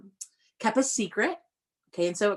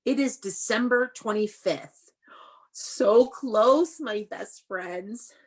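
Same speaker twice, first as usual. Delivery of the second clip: put-on reading to children; 5 semitones higher than usual. Teacher reading a Christmas book to her class, sing-song storybook voice. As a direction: read it like a treat for little ones.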